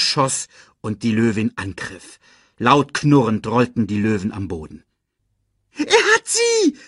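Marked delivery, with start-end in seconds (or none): 5.8-6.8 very high, loud excited screech. A narrator's reading. Timon watches Simba attack, screeching excitedly. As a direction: screech it like a hopping spectator. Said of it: "kreischte Timon".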